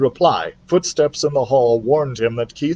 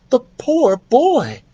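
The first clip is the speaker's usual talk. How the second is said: higher, slow, pitying; slow pitying mother mouse; 12 semitones higher, 3 dB louder, slower than usual. Acted. Ralph's mother pities the sick boy, slow and high. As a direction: sigh it out like a fretting mother.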